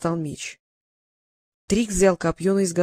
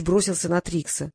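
ordinary delivery

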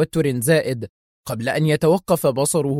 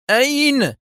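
high, sharp one-word demand; 12 semitones higher, 4 dB louder, slower than usual. A narrator's reading. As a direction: fire the question, pitch leaping up. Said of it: "سأله في حدة".

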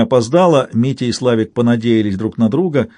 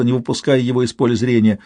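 ordinary delivery

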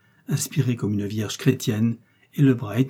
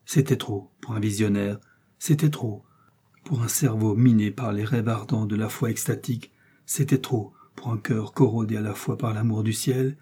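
plain reading voice

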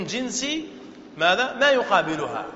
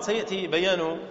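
ordinary talk

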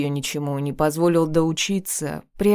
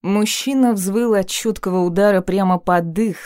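ordinary delivery, nothing marked

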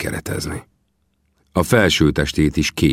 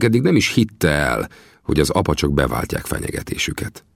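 plain reading voice

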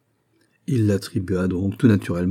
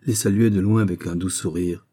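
usual speaking voice

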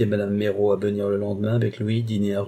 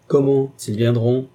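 slow, louder, disbelieving Comment; astonished question; 4 semitones higher, 6 dB louder, slower than usual. A narrator's reading. Carquebus cannot believe she asks this, astonished. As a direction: drag out the surprise, louder and slow.

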